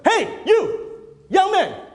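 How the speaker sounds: sharp, high-pitched shout of a speaker calling someone out; yelling. Retold or imitated. Speaker singling out an inattentive attendee from the stage, yelling. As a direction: bellow it at someone across the room. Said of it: "He was yelling at me".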